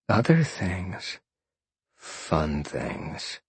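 hushed, slow, suggestive. A narrator's reading. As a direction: drop to a soft, teasing near-murmur. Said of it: "lowering his voice".

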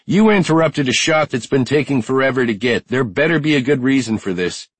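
high-pitched angry woman's yell; irate yell. A narrator's reading. Shanna storms over about the ruined shot, irate yell. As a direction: yell in annoyance at the interruption. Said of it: "Shanna yelled".

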